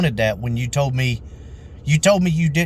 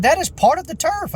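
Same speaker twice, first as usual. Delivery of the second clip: very high-pitched imitation of an outraged customer; indignant insistence. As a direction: mimic her insisting, voice shooting way up. Customer's side of retold argument.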